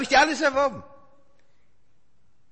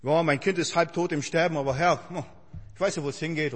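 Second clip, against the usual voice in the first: mock composure; 5 semitones lower, 4 dB quieter than usual. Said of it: "schauspielerst ihn".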